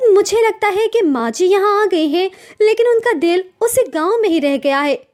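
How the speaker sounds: a wounded daughter-in-law venting to her husband; young wife complaining. Acted. Sonam talks to Rahul.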